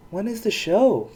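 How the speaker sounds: bright eager question about the pony show; excited. A narrator's reading. Poniella asks excitedly about the show. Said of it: "Poniella asked excitedly".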